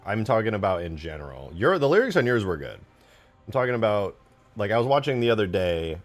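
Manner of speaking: normal talking voice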